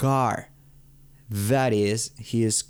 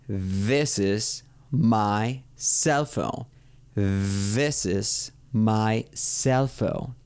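very slow, word-by-word teaching delivery; exaggerated model pronunciation; much slower than usual. Shown as a demonstration. Teacher giving learners an example sentence, slow exaggerated diction. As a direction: space out every word and lean on the th. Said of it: "pueden exagerar un poco para que".

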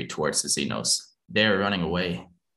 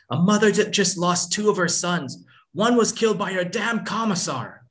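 grief-stricken rage; 11 semitones higher than usual. Acted. Grieving mother shouting at the lieutenant, raging. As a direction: throw the grief back in his face.

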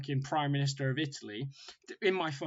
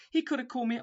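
usual speaking voice

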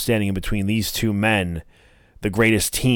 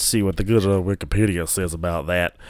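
casual mock-Southern voice; Southern accent. Real, not acted. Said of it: "did a fucking Southern accent".

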